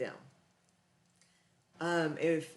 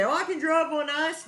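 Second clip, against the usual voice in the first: high, loud, cocky mock of a boastful driver; mocking overconfident driver; 9 semitones higher, 6 dB louder than usual. Vlogger mocking overconfident icy-road drivers, high, loud and cocky. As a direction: scoff at the know-it-all who claims he can drive on ice. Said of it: "people who say".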